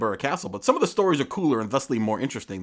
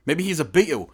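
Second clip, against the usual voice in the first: ordinary delivery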